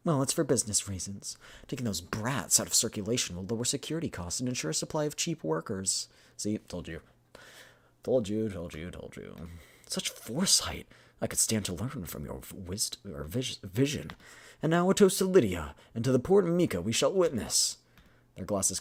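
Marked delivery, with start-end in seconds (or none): 0.1-6.1 lightly acted, pragmatic schemer; businesslike game character; scheming businesswoman. Voices the businesswoman's game dialogue. 9.9-17.8 theatrical flattery rising into a toast; admiring game character, getting grander; admiring toastmaker. Acted. Voices Carl toasting Lydia.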